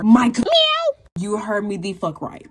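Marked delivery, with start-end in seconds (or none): 0.4-1.0 high squeaky cat meow in place of the word; censoring meow. Pretend. To viewers, censoring a body word with a meow. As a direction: bleep it with a meow.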